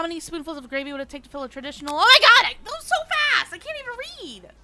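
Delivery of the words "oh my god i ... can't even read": much higher and louder, frantic; panicked shriek. Real, not acted. Player panicking as the timer races, frantic shriek. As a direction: freak out at the timer.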